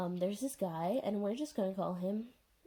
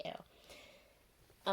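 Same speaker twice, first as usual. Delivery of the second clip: disgusted ew; 7 dB quieter than usual. Retold or imitated. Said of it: "she just looks at them and she's like".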